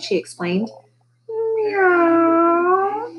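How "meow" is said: very high, long drawn-out meow; stretched, high-pitched mew; crying cat. A narrator's reading. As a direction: wail like a cat. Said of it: "it cried".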